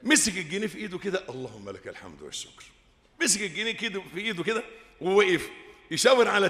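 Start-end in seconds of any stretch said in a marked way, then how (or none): 1.3-2.6 much lower and softer grateful murmur; hushed prayer of thanks; quiet thanks. Retold or imitated. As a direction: breathe out relieved thanks to God. Sheikh thanks God finding pound.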